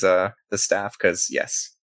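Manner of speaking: usual speaking voice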